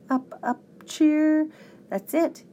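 dawning idea, pitch climbing; rising, eager exclamation; crying out. A narrator's reading. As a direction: build up excitedly to a sudden realization. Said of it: "he cried".

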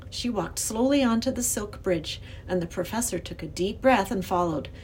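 plain reading voice

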